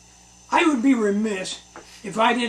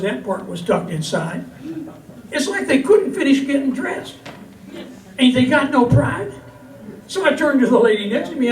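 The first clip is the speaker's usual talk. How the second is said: lively, lilting comic verse for a crowd; cowboy poetry recital; 5 semitones higher than usual. Acted. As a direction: tell it as a rhyming yarn from the stage. Recites comic cowboy poem to audience.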